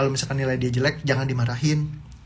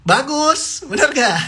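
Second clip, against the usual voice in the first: delighted praise; 12 semitones higher, 7 dB louder, much slower than usual. Acted. Praising child's improving grades.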